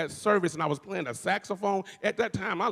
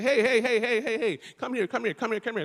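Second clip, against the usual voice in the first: excited calling out; 6 semitones higher than usual. Retold or imitated. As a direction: call him over across the room, excited. Prophetess calling him over.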